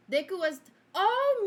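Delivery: normal talking voice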